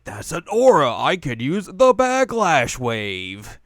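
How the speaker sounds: loud, high, slowed-down eureka; excited realization. Retold or imitated. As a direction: make the big discovery loud. Inuyasha voiced realizing.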